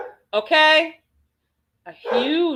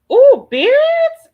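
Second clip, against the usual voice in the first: high, loud delighted ooh at beards; light up at the idea; thrilled shriek of approval; excited squeal; 12 dB louder than usual. Real, not acted. Reacting to chat turn-ons.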